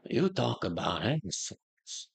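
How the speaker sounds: slow, soft YouTuber impression; a hint of Italian-American wise guy; John Bravo. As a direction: lightly imitate a YouTuber, drawn out and quiet. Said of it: "sorry, John Bravo, the guy, the YouTuber".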